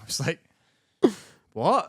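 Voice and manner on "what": raised, louder baffled "what?"; higher-pitched, incredulous retold reaction. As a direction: react with mock disbelief. Baffled reply to knife offer in retelling.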